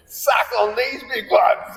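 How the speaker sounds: a slow, high, gloating jeer; slow mocking taunt. Taunting a driver he cut off.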